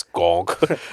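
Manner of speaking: low droning robot gonk; gonk droid noise. Retold or imitated. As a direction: grunt it like a clunky droid. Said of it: "a trash can that walks and goes".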